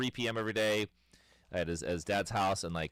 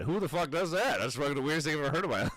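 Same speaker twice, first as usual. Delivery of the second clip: incredulous; 6 semitones higher, 4 dB louder than usual. Retold or imitated. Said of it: "I'm just like".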